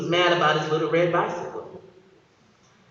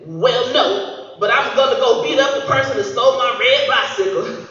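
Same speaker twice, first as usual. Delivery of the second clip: put-on laughing boy; 6 semitones higher, 5 dB louder than usual. Boy insists on fighting.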